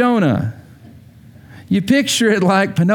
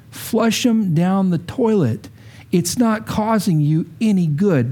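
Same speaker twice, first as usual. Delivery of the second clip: ordinary delivery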